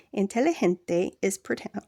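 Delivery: ordinary talk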